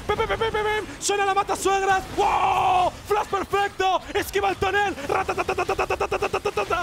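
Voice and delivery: high-pitched rapid-fire fight call; excited esports play-by-play. Acted. Caster calls a chaotic team fight, excited high play-by-play. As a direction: build the fight to fever pitch. Live match casting.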